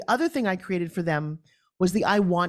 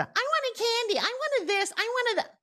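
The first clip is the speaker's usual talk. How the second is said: put-on much higher, whining toddler voice; whiny little kid; 3 dB quieter, slower than usual. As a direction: nag like a toddler begging for candy. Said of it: "moms would have to listen to".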